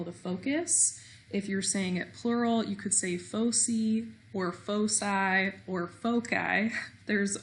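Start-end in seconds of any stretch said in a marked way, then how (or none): 3.2-4.1 "foci" said as FOH-see; soft-c foci. Shown as a demonstration. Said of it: "lots of different ways people pronounce it". 4.5-5.5 "foci" said as FOH-sigh; soft-c foci, long i. Shown as a demonstration. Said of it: "lots of different ways people pronounce it". 5.9-6.7 "foci" said as FOH-kai; hard-c foci. Shown as a demonstration. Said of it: "lots of different ways people pronounce it".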